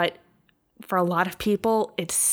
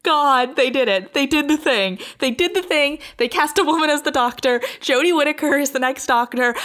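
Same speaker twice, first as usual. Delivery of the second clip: high, loud and bubbling over with joy; overjoyed excitement; 9 semitones higher, 4 dB louder than usual. Real, not acted. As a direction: burst out with giddy, breathless delight at the news. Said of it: "I am still shaking with happiness".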